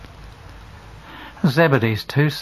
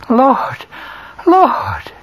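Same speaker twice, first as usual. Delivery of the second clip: much higher, louder, rising cry; breathless cry; 13 semitones higher, 4 dB louder than usual. Acted. Peter on the beach cries out to Jesus, overwhelmed. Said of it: "breathless because of his emotion".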